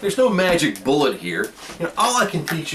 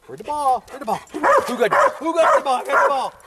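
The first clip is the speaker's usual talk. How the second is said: dog hype voice; 12 semitones higher than usual. Real, not acted. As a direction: pump the dog up. Hyping up the dog.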